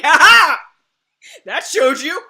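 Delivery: loud, crowing taunt; mock triumph. Viewer jeering once the attacker is bitten, mock triumph. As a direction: crow it like a gleeful victor. Acted triumphant jeer at villain.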